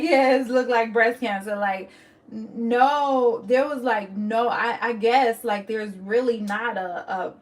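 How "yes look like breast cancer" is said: louder mock-casual "Yes, look like breast cancer"; mocking offhand verdict. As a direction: parody the flat offhand diagnosis, louder. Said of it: "how do you just go from".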